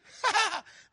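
high, loud burst of laughter; exultant laugh. Real, not acted. Preacher delighting in the empty tomb, exultant laugh.

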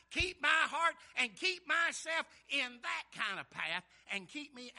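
loud, building pulpit cry; full-force preaching. Acted. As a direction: preach it with rising force and weighty stretched phrases. Preaches David's words forcefully.

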